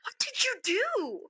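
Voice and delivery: high, slow, stunned question; amazed boss. Retold or imitated. Operations director stunned after the angry call, slow high disbelief. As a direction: gape at what just happened.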